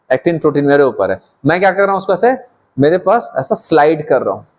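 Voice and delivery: usual speaking voice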